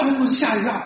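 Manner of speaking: ordinary talk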